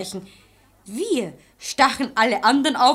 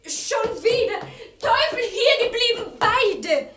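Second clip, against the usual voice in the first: much higher, shrill exasperated cry; 11 semitones higher than usual. Acted. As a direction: stop them both in frustration. Maid exasperated calling both back.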